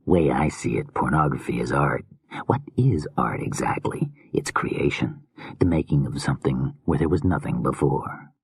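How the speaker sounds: soft creepy murmuring killer; hushed, unsettling madman musing; menacing whispery killer. Acted. Creepy killer muses on art.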